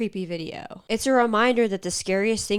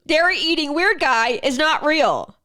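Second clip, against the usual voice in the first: higher, louder denial; panicked insisting; 7 semitones higher, 5 dB louder than usual. Acted. Telling yourself after the nightmare, loud denial. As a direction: insist hard, rattled.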